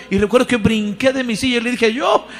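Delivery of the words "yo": a sudden high shout of volunteering; eager shout. Retold or imitated. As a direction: call out eagerly, jumping up. Jumped from seat, answered preacher. (grito entusiasta y agudo)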